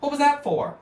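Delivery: very high, loud indignant whine; hammed-up indignant cartoon whine. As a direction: shriek at the one who hit you. Hit victim complains.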